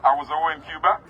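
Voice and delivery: normal talking voice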